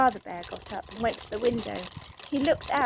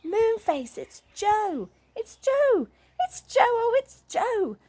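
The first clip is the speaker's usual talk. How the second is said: rising shouts, much higher and louder; yelling excitedly; 13 semitones higher, 6 dB louder, slower than usual. A narrator's reading. Sisters recognizing their brother's voice, yelling higher and louder. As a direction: call out with mounting joy. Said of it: "yelled out loudly".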